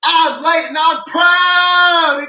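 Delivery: drawn-out, high, loud shout; with a lot of might. Shown as a demonstration. Host showing viewers how to say the slogan, mighty shout. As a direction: belt it out, stretching every word. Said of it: "say it with, with a lot of might".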